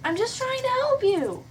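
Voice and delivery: high, pleading cry. Acted. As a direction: beg him to let you help. Hannah pleads with the boy.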